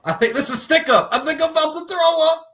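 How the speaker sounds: very high, loud hammed-up character voice. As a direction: push the caricature high and loud.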